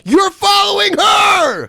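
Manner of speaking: high, drawn-out yell; exasperated shout. Real, not acted. Exasperated yell back at video guy.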